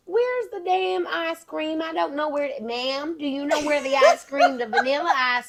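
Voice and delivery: put-on Southern accent. Host re-enacts asking a Walmart worker, Southern accent. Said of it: "Southern accent".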